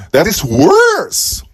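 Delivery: slow, high stress on worse; mock exasperated comeback to the family; emphatic retort. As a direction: land on worse, drawn out and high.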